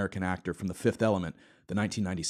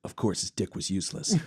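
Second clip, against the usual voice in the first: ordinary talk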